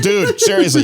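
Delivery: exasperated commander hurrying lovers, laughing; mock exasperated. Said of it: "He's like".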